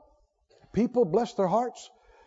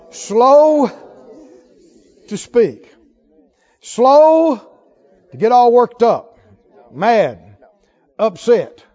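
slow drawn-out words, much louder, hammered home; emphatic preaching shout; 9 dB louder, slower than usual. Acted. Preacher warns his congregation with a booming, emphatic delivery. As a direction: preach each word out hard to the crowd. Preacher pounding out scripture advice.

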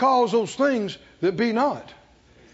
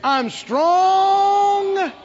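high, sustained rallying shout; slow, ringing chant at full voice; full-strength declaration; 8 semitones higher, 4 dB louder, much slower than usual. Shown as a demonstration. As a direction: proclaim it loud, stretching the words out. Strength is coming up.

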